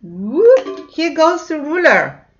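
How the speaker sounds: high, loud excited call; teacher cheering loudly as she feeds the monster. Pretend. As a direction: cheer as the ruler goes in. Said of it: "feeding the monster, excited".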